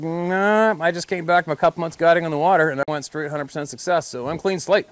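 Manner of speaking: smug denial. Retold or imitated. Retold denial continues.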